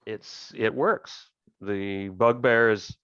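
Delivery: ordinary delivery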